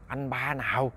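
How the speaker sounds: very soft, doubtful question; hushed puzzlement. Acted. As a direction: ask it quietly, wary and confused. Puzzled wife answers her startled husband. (hỏi khẽ, đầy ngờ vực)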